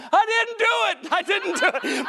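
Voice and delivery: high, laughing denial of blame; mock protest. Preacher pretends to tell the pastor, mock denial. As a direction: playfully plead innocence, high. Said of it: "I almost turned to him and said".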